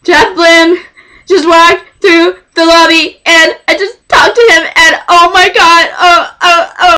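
high, breathless-with-excitement phone babble; overexcited fan freaking out; hysterical fangirl. Retold or imitated. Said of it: "lower the register maybe a little bit".

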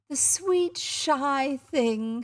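high, sugary coo. A narrator's reading. Visitor doting on the boy, high cooing. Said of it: "she cooed".

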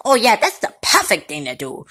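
ordinary talk, nothing marked

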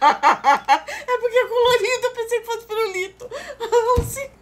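high laughing voice. Real, not acted. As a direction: laugh through the words. Laughing at own mistake.

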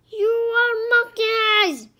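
loud, high, outraged outburst; shouted. A narrator's reading. King Arthur objecting to the choice, shouting. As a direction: shout it in indignation. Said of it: "King Arthur shouted".

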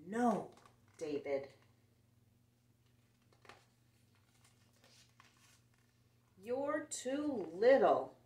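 loud, slow big-brother scolding; bossy big brother. Acted. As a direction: lean on it like a bossy older brother telling him off. Big brother scolding, read aloud.

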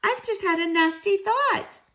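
high-pitched urgent interjection; crying out. A narrator's reading. Child stops grandmother with a worry, crying out. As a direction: halt the listener with a high voice. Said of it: "I cried".

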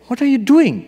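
high, loud irritated outburst. Retold or imitated. Irritated king interrupting the sage's chant, loud high outburst. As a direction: snap at him, fed up. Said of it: "gets irritated".

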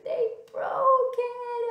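high, loud mock wail; crying baby bear. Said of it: "started crying and he said".

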